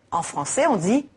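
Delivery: ordinary talk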